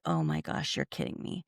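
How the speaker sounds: low, near-whispered disbelief; you're kidding me, said under the breath in shock; stunned hush. Retold or imitated. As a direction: drop to a stunned hush, barely voicing it. Stunned reaction to shocking news.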